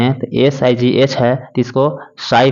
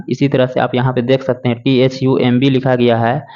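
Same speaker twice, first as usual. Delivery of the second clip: ordinary talk, nothing marked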